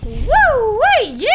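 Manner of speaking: loud, high victory cheer; excited whoop. Real, not acted. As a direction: celebrate the kill. Victory after kill.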